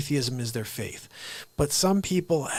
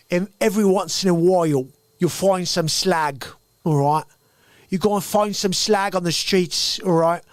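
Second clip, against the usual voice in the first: mock Cockney accent, louder; 4 semitones higher, 6 dB louder than usual. Host explaining accents, slipping into a louder mock Cockney accent. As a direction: play a rough London Cockney and lean on it. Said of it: "there's Cockney".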